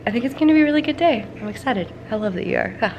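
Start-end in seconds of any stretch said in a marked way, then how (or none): none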